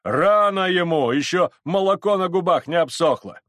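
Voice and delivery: high, loud heckle from crowd; shouted jeer. Acted. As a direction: shout it scornfully over the mob. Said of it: "кричала другая".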